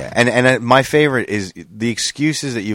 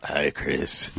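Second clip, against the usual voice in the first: put-on older voice; 7 semitones lower, 6 dB quieter than usual. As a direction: lower aged voice imitation. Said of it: "what I picture you're gonna sound like in thirty years".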